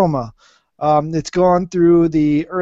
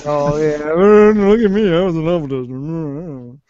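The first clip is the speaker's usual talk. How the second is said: louder, slower, hammed-up boast; mock bragging; 3 dB louder than usual. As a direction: puff up like a show-off. Mocking a bragging friend.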